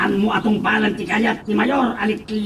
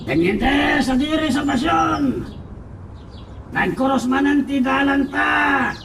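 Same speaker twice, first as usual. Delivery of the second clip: angry shout; 6 semitones higher than usual. Acted. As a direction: shout the threat across the road. Thug shouting warning at lieutenant.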